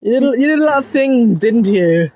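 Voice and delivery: mock accusing, as if uncovering dirt online; imagined police vetter. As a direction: play an officer who has found something incriminating. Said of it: "scroll through my YouTube and go".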